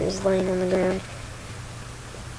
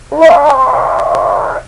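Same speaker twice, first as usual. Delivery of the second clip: long, high, loud bellow; creature roar; 5 semitones higher, 7 dB louder than usual. Acted. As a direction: roar like a huge beast. Creature roars at missile.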